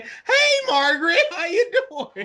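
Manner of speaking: high, sing-song syrup bottle coming alive; mock Mrs Butterworth. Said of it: "started coming alive and saying".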